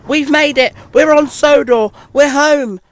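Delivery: high, loud jubilant shout; elated homecoming cheer; triumphant cry. Acted. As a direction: cry out in joy and relief. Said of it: "Thomas cried as they reached the bottom".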